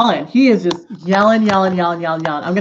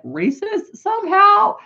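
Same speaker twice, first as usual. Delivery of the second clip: incredulous laugh; 12 semitones higher than usual. Real, not acted. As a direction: laugh in disbelief at the absurd claim. Incredulous laugh at absurd claim.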